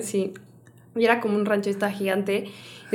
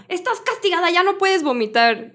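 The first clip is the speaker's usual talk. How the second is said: scolding mother; 9 semitones higher than usual. Retold or imitated. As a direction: imitate a mum laying down the punishment. Imitating mother punishing vomiting daughter.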